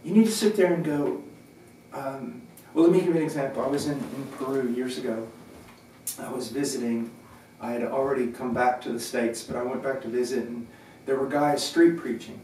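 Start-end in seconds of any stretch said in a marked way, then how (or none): none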